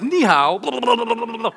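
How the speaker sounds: high-pitched babble imitating a fluent speaker; mimicked fluent Chinese. [name] comes by speaking Chinese, mock-fluent high babble.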